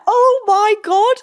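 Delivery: high, slow, drawn-out disbelief; stunned. Retold or imitated. Housemate retelling hearing everyone's reaction, stunned. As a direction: stretch it out in astonishment.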